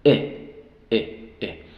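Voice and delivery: "E" as a short lax English "ih"; short, clipped-off "E", the vowel of "pick"; short I sound. Shown as a demonstration. Teacher models the short I for students, "E" said as lax "ih". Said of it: "El sonido corto de la I es".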